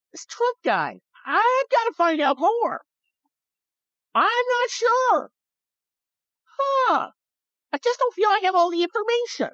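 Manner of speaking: very high, squeaky talking-dog voice; Barky the dog. Acted. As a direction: play a puzzled voter as a squeaky cartoon dog. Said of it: "that's the voice of Barky the dog".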